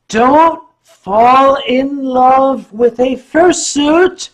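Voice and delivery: slow, loud, high proclamation; emphatic warning. Real, not acted. Performer warns fans about fursuits, loud slow warning. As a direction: belt out the advice, stretching each word.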